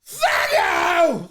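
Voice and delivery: high, drawn-out mock holler; imitated shout. Streamer copying his favorite moment of Mac yelling, mock shout. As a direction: belt it out high, relishing the imitation. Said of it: "I like when he's like".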